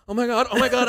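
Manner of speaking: loud, high alarmed muttering to self; imitated frantic alarm; mock panic. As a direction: blurt it while hauling a dog away. Acts fleeing with the dog.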